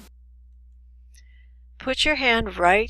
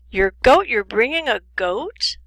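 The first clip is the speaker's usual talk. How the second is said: incredulous; 2 semitones higher, 7 dB louder than usual. Acted. Listener thinks a goat is coming along, loud incredulous questions. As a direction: repeat it louder in astonishment. Acted disbelief at mispronounced coat.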